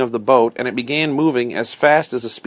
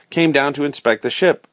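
ordinary delivery

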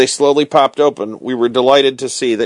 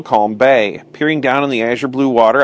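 ordinary delivery

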